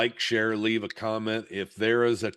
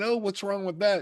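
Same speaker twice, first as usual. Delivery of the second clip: much higher, puzzled question; surprised question; 10 semitones higher than usual. Retold or imitated. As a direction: sound honestly puzzled. Mother surprised on phone.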